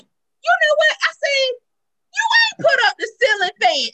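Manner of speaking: high, loud retold scolding; pissed off. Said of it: "It pissed me off because I was like".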